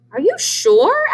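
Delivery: high, loud doubtful goose. Acted. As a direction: ask it squeaky high. Goose voice questioning.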